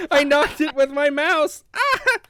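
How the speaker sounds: loud, high wail over a mishap; real dismay. Real, not acted. Host explaining the wine spill, wailing in dismay. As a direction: wail out what went wrong.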